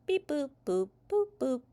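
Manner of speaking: high, short electronic boops; imitated robot beeps. A narrator's reading. Robots told to relax, high beeping noises. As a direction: toot out little machine noises.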